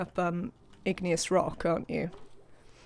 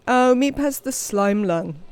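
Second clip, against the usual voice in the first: dismayed surprise; 4 semitones higher, 7 dB louder than usual. Real, not acted. Colonist found sick.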